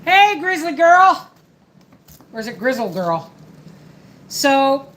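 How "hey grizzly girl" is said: high, loud, slow drawn-out hey; excited greeting. Real, not acted. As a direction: greet a viewer warmly and loudly. Greeting a viewer in chat.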